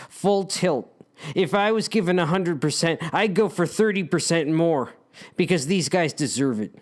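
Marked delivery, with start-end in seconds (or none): none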